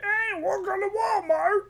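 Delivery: high put-on greeter voice; imitating a Walmart greeter; door greeter. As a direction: chirp the greeting. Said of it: "door greeter who's all like".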